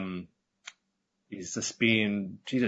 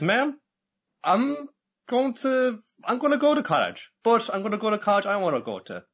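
much higher, louder, emphatic declaration; defiant young man; 11 semitones higher, 4 dB louder than usual. Retold or imitated. Son telling his mother he'll choose his own path, high and loud. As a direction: voice the son standing up for himself, loudly and high.

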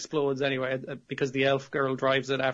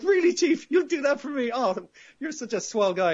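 hammed-up gushing Kay; 10 semitones higher than usual. Kay gushing to the chief about the new car, in a much higher hammed-up voice. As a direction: gush like a starstruck kid thanking his hero.

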